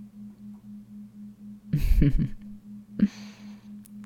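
breathy scornful giggle; whispered mocking laugh. (ضحكة هامسة ساخرة)

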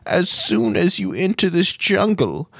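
high, slow, ominous gnome wizard warning; old gnome wizard. Acted. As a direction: warn of the curse like a creaky old sage.

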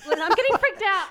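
high, loud giggly excitement; laughing excitedly. Real, not acted. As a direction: laugh through the words. Giddy over gifts.

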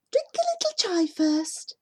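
put-on high, thin old crone voice; a lightly voiced old woman urging. A narrator's reading. Old woman challenging them.

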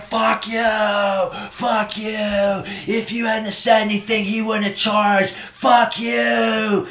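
high, strained raging-woman voice; mock screaming. Raging dog owner yelling from her car, mock screaming. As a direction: imitate her screaming at the top of her lungs without full volume. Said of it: "screaming at the top of her lungs".

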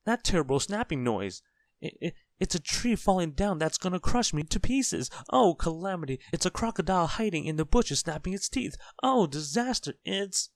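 high, loud, hysterical babble; terrified shriek. A narrator's reading. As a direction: wail escalating fears until cut short. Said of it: "he shrieked".

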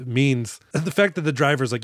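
ordinary talk, nothing marked

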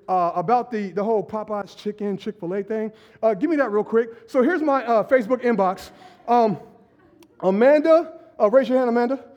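ordinary talk, nothing marked

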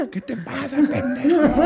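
very high, strained protest with laughter; mock outrage. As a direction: squeal the insult in comic indignation. Acting out outrage at being bitten. (indignación fingida, muy aguda)

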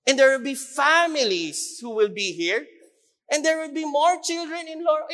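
loud, rousing sermon delivery; fiery preaching. Acted. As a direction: build it up like a preacher rallying the crowd. Preaching about more jobs.

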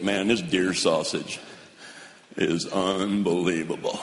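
low, slow mock-impressed buddy; buddy raving. Buddy raves over deer sausage, low and slow. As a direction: drag out unbelievable like a dazzled buddy. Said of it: "He's like".

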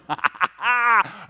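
loud, high belly laugh put on; big acted laugh. Preacher playing God of the universe, booming mock laugh. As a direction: laugh out loud as if delighted by the panic.

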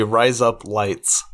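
put-on Australian vowels; Australian accent. Shown as a demonstration. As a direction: RISE UP LIGHTS run together to sound like Aussie "razor blades". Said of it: "If you wanna say razor blades".

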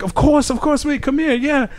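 high, badly faked British accent; mock a British man's voice. Retelling the star's reply in a bad British accent. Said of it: "Sorry, it's a horrible accent".

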